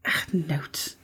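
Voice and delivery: unvoiced mocking whisper. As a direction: whisper it like a scandal. Mocking his prepared notes.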